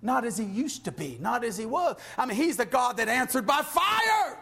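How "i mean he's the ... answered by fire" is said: high, impassioned pulpit delivery; fervent preaching. Real, not acted. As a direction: preach it with rising fervor. Preaching at full force.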